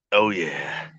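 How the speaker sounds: ordinary delivery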